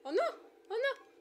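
high, loud panicked cries; panicked screaming. Real, not acted. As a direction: shriek in alarm. Turret attacks player.

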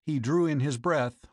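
plain reading voice

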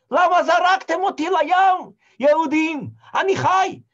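high, loud shouted protest; shouting after the ship that he is alive; desperate shout. Acted. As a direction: yell after the ship, begging.

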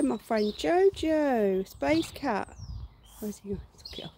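usual speaking voice

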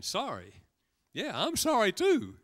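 sarcastic customer. Retold or imitated. Customer with gravy on his coat mocking the waiter, sarcastic. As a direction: throw the apology back, dripping sarcasm.

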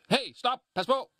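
sudden high yell to stop someone; warning shout. Pretend. Father calling to his children near a motorway, high urgent shout. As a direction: call out a sharp, urgent warning. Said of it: "Hvad hvis de er på vej ud på en motorvej?".

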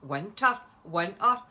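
loud, hammed-up Scottish WINTER; mock her own Scottish accent, loudly; exaggerated Scottish accent. Shown as a demonstration. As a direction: punch WINTER out in broad Scots. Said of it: "I sound really Scottish this morning".

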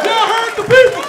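rally the room with a big shout; shout to crowd. Real, not acted. Shouting back after heckle.

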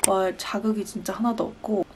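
ordinary talk

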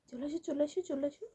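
soft, hushed coaxing to come in; hushed calling. Real, not acted. Parent softly coaxes child to tiptoe in, hushed. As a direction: quietly call them in, almost under the breath.

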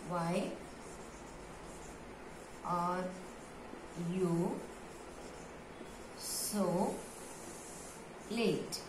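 softly, each word on its own with long pauses; word-by-word dictation. Shown as a demonstration. Teacher dictating to pupils, soft word-by-word pace. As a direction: dictate slowly, leaving time to write.

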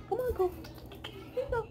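high, soft coaxing for a frightened dog; soothing pet voice. Real, not acted. Owner coaxing her shaking dog from under the bed, high and soft.

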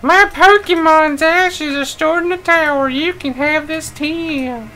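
reading in a high put-on voice; squeaky character read; high game character voice. Reads game character line.

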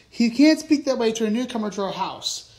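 mildly shouted story dialogue. A narrator's reading. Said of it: "shouted Papyrus".

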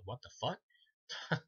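very slow, stretched-out confusion; mock baffled Pinhead. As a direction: drag it out like a dumbfounded villain. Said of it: "He just has a look on his face like".

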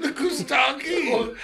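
high laughing shout back; cracking up while shouting the comeback; laughing retort. Real, not acted. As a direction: laugh it out as you fire back.

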